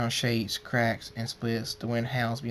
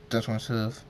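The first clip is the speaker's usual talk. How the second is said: ordinary delivery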